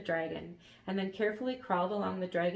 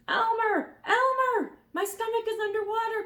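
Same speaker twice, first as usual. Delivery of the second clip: very high, loud alarmed cry; 9 dB louder than usual. A narrator's reading. Dragon wakes and cries out to Elmer. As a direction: wake up shouting in alarm. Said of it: "cried the dragon".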